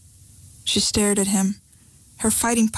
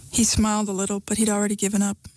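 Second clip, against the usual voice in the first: ordinary delivery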